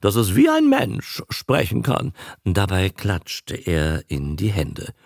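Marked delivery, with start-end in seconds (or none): 0.0-2.1 loud, high ceremonious claim; a barker announcing a talking beast; solemn raised voice. Acted. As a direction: proclaim it like a marvel. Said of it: "hob feierlich die Stimme".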